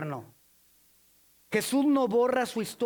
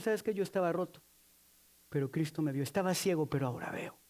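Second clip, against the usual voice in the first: hushed, lower, tender confession; soft testimony; 5 semitones lower, 5 dB quieter, faster than usual. Pretend. Believer quietly giving testimony, soft and tender. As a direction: confess it softly, like a grateful witness.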